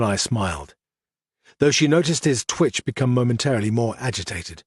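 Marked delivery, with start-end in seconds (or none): none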